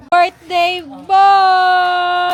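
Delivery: loud, very slow, stretched-out call; drawn-out call. Real, not acted. Vlogger hailing the birthday boy, drawn-out call. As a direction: call out with a long held last word.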